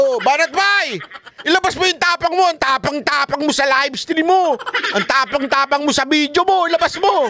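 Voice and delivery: high, goading mock yell; mock taunting yell. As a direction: heckle him, daring him to show his guts. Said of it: "pag tayo, ginanyan tayo". (panunuksong sigaw na kunwari)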